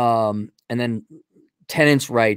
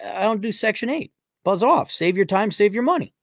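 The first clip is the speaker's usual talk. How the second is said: higher-pitched, curt turn-down; dismissive brush-off; 7 semitones higher than usual. Acted. Landlord turning away an applicant, dismissive brush-off. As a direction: wave them away in a raised voice.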